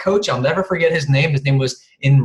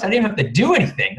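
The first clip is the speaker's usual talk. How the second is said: mock excited; 6 semitones higher, faster than usual. Mocking wife's excitement.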